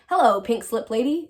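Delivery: storybook shout of hello; calling out. A narrator's reading. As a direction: call it out in greeting. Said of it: "called out as she rode up".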